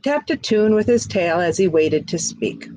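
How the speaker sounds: usual speaking voice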